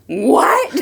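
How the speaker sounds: comic shocked what; mock disbelief. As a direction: act out an appalled double take. Acting out friend's appalled reaction.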